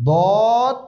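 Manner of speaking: দদ heavy, full and drawn out; thick দদ. Shown as a demonstration. Teacher showing the heavy letter, thick drawn-out দদ. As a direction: fill the mouth for a thick, long দদ. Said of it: "দদ মোটা হবে".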